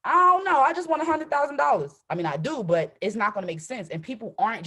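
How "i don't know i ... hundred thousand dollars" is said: high, loud, whiny shrug of a voice; clueless whine. Pretend. A speaker mocking someone begging for money with no plan, in a high whiny voice. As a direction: play the clueless asker who just wants cash. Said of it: "I can't be like".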